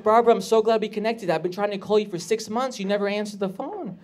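upbeat producer on the phone, pitched slightly up; Josh. Retold or imitated. Producer of Hoarders asking for Barbara, upbeat pitch.

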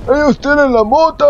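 high, loud mocking squeal; mock idiot onlookers. Gamer voicing the other fools who spot weed, high mocking squeal. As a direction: squeal it like excited stoner fools. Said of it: "los otros pendejos que dicen".

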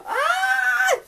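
sharp high excited scream; excited gasp and shriek. Real, not acted. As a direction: gasp then a high squeal. Finally opening awaited package.

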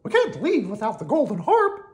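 high, loud cartoon mouse; determined Mickey. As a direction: insist in a high firm voice. Mickey insists.

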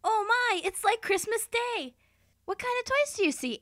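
high, gushing delight; excited. Acted. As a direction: squeal with childlike glee. Anchor gushing over toys.

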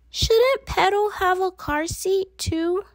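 put-on high, anxious child's plea; worried little girl. A narrator's reading. Wimberly asking about her doll, fretful high voice. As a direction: fret over the doll's safety.

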